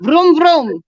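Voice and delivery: loud high vroom vroom; engine noise. Pretend. Friend asks her to redo the sound, loud vroom vroom. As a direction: rev like an engine. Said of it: "what was that?".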